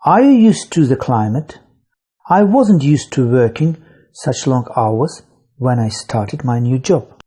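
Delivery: ordinary delivery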